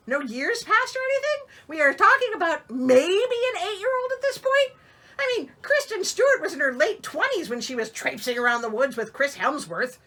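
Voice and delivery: high and loud exasperated rant; indignant protest. Real, not acted. Reader protests to listeners about the movie, exasperated. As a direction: complain like you've been cheated.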